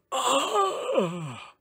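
high wailing cry; dinosaur cries its name. Acted. Dinosaur introduces itself with a high cry. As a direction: let out a sudden creature cry. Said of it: "it said".

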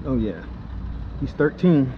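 ordinary talk

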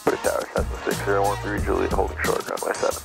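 tinny pilot call over radio; muffled cockpit radio reply; air traffic control radio. Real, not acted.

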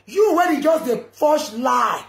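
loud slow accusing shout; angry accusing yell. Real, not acted. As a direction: shout it out, dragging slowly. Shout heard.

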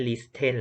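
"listen" as lis-ten, the t spoken; T sounded. Shown as a demonstration. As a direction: say "listen" wrongly, voicing the t. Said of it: "เราไม่ใช่ listen แล้ว".

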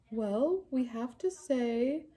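ordinary talk, nothing marked

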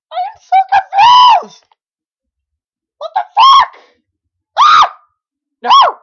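very high, loud confused yells; real freaked-out screaming; panicked shrieking. Real, not acted. Chaos on screen.

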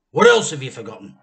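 loud impatient demand; stern demand. A narrator's reading. Mr Cranky interrogating George, loud stern demand. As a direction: press the boy hard.

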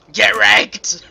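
high, very loud taunt; loud gloat. Real, not acted. Gamer taunting the beaten opponent, loud gloating yell. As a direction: yell it in triumph.

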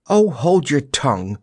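high-pitched snappy retort; snappish. A narrator's reading. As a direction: cut someone off irritably. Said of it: "snapped Jellia".

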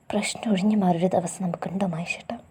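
hurt, whispered and hushed; a girl whispering her pain; despairing whispered question. A narrator's reading. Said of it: "വേദനയോടെയുള്ള ശബ്ദം".

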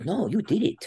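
high mock innocent voice of the coach. Coach insisting the wrestler earned it, high and innocent. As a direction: play it high and falsely innocent. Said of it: "And he goes".

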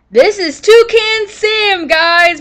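slow, high and loud announcement; excited reveal. Real, not acted. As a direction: present him like a proud host. Proud reveal of named parrot.

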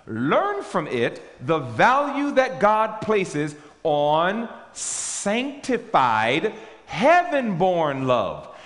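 slow, weighty reading of a quote; preacher reading a sacred quote, slow and loud; solemn reading. Acted. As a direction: read it out like scripture, slowly, with weight. Reads quote from the pulpit.

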